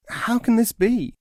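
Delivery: high, amazed question; astonished pious man. Acted. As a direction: gasp out the disbelief.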